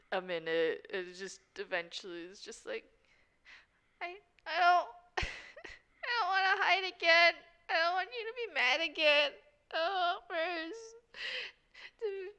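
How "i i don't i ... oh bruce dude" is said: high-pitched, whimpering character voice; acted tearful plea. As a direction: plead through pretend tears, drawing the words out. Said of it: "she does just start to cry".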